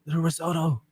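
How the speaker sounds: put-on half-hearted Ramsay accent; mild take on a chef's British accent; Gordon Ramsay impression. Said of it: "I can't really do his accent".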